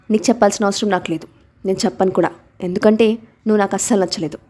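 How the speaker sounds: usual speaking voice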